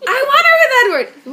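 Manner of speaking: high, loud imitation of mom; hammed-up distress; mock upset wail. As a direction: wail it like an overwrought fan. Imitating mom upset over the book.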